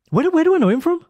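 high, loud mock accent; Shindy in put-on accent. Wife interrupting the film with a question, high mock accent. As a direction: imitate the wife in an exaggerated accent, high-pitched. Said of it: "why I gave Shindy an accent there".

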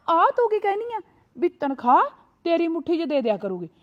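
ordinary delivery

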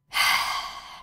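long breathy sigh. Acted. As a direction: let out a weary sigh. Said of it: "sospirò". (lungo sospiro)

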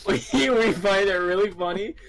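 loud, high giggly talk; laughing loudly. Real, not acted. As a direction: blurt it out laughing.

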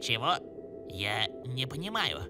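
low, soft, puzzled; quiet confusion. Acted. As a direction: ask softly, not understanding. Confused by brother's warning.

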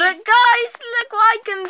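high eager call to viewers; excited showing off. Pretend. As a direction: sound thrilled to show a trick.

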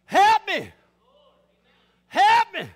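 high, full-force shout for help; loud, high, desperate yell; hollered plea. Acted. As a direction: holler as if for rescue. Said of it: "wait for us to holler".

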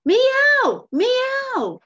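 high, loud cat cry for help; insistent meow. Shown as a demonstration. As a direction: yowl like a cat in trouble. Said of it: "But then the next one is".

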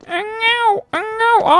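high fake baby wail; mock baby crying. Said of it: "Ağlıyor musun?". (sahte bebek ağlaması)

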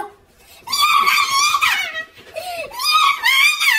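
very high, drawn-out hysterical cry; panicked wail. Acted. Boy alerts principal about sister, panicked wail. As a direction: wail it out slowly in mock despair.

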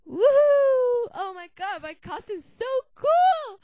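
loud, high cheer of delight; thrilled. Real, not acted. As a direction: shout for joy over the costume. Thrilled with costume.

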